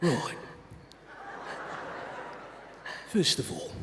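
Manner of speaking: put-on Jason Statham British accent. Host re-introducing the speaker to the audience, in a tough British accent. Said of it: "I'll do the British accent, your whole re-intro, British accent, but as Jason Statham".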